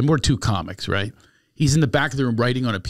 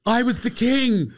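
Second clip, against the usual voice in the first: higher, slow, offended; indignant correction; 12 semitones higher, 5 dB louder, slower than usual. Retold or imitated. Teacher correcting Bruce, high offended drawl. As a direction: set him straight, affronted. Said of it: "he goes".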